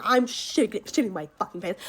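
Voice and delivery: ordinary talk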